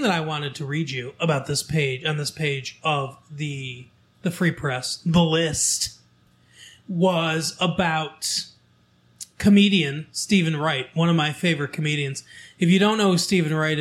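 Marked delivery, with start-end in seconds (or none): none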